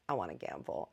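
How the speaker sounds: a hint of an old man wanting his fun; low old man voice. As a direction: croak it out like a frail grandpa. Grandpa voiced in the story.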